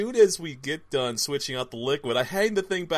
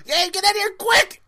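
imitated yell, much higher and louder; mom yelling; 7 dB louder than usual. Retelling how mom called him in from the kitchen, high mock yell. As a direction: call urgently from the kitchen, mock alarm. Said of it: "she just yells out".

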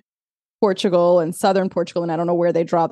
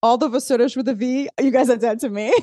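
higher-pitched playful disowning, breaking into laughter; joking mock threat; 4 semitones higher, faster than usual. Podcaster teasing listeners who voted, joking mock threat. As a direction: disown them in jest, laugh at the end. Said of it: "I'm just kidding".

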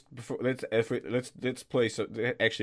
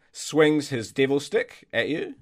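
usual speaking voice

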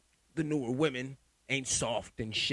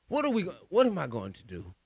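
ordinary delivery

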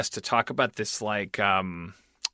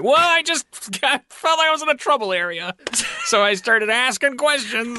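high sheepish excuse; 11 semitones higher, 3 dB louder than usual. Retold or imitated. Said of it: "I'm like".

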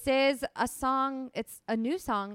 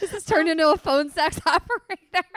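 laughing; 7 semitones higher, 7 dB louder than usual. Real, not acted. Laughing at the sultry reading.

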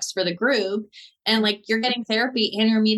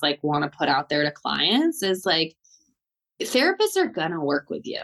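ordinary delivery

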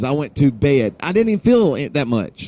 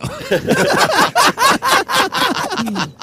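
stoner giggle; 3 dB louder than usual. Real, not acted. Giggling at being high.

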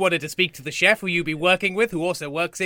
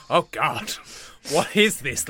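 ordinary talk, nothing marked

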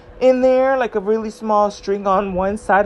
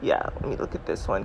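breathy aside to herself; whispering; 13 semitones lower, 10 dB quieter, faster than usual. Real, not acted. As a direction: murmur it under your breath. Muttering to herself over chart.

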